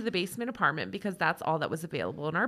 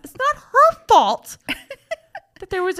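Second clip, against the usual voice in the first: indignant defence; 12 semitones higher, 8 dB louder than usual. Real, not acted. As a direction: stick up for her loudly. Mock-scolding defence of the mother.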